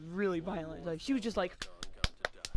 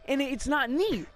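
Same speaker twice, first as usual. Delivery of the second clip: normal talking voice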